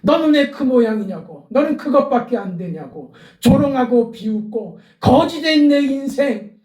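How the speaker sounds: loud, high, pleading cry to the Lord; a preacher voicing Bartimaeus's cry for mercy; impassioned preaching. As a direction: plead with the Lord at full force.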